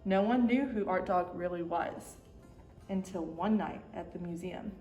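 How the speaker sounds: ordinary delivery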